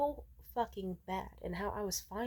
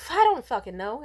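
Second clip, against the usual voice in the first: louder, exasperated burst on fucking; exasperated outburst; 5 semitones higher, 10 dB louder than usual. Real, not acted. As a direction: blurt it out fed up. Frustrated rant about former friends.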